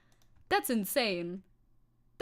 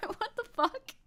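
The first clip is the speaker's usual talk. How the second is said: laughing; 12 semitones higher, slower than usual. Real, not acted. Laughing disbelief.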